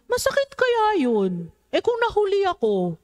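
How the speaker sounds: preacher playing the child who sees no harm; child's voice. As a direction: sound like a puzzled little child.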